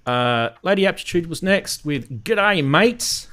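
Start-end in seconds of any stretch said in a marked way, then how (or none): 2.2-3.3 put-on broad Australian Ocker accent. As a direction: lay on the Aussie Ocker accent. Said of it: "She's trying her Ocker accent".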